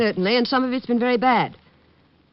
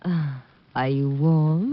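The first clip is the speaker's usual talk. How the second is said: slow flirt; 3 semitones lower, much slower than usual. Acted. Actress flirting with detective.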